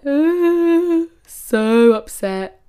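high, loud, drawn-out despair; mock anguish. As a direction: wail it slowly. Heartbroken on the train.

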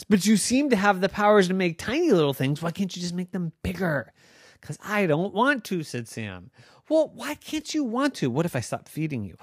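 put-on high, fast, whiny girl voice; arguing girl Noah. Noah threatening the fish.

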